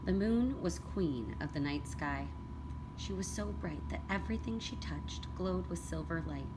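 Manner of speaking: usual speaking voice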